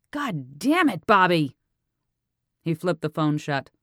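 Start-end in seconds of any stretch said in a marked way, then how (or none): 0.1-1.6 exasperated yell, louder; angry shout. Acted. Detective furious as Bobby refuses, shouting his name. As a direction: throw the curse at him furiously.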